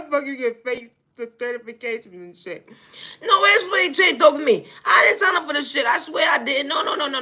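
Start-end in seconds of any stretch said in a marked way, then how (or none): 3.2-7.2 louder, higher panicked protest; panicked shout. Acted. Panicked rant about the stolen plane.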